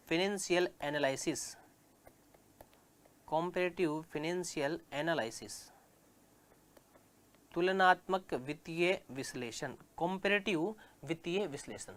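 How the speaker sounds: drawn out so students can copy it; dictating the English term to the class; slow dictation. Shown as a demonstration. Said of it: "dictating English term slowly".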